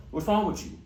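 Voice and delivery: high, clipped "What's wrong with you" with wrong punched; the DMV way, short and sharp; DMV delivery. Shown as a demonstration. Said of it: "because I'm from".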